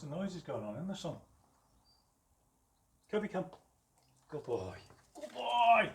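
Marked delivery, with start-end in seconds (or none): 3.1-3.4 high, loud recall call to the dog. Real, not acted. Trainer recalling his dog. 5.1-5.9 excited praise. Real, not acted. Praising dog, much higher and louder.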